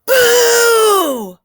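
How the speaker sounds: a huge high shout of Boo. Acted. Elmer startles the silent herd with a huge shout. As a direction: leap out and yell Boo to startle them.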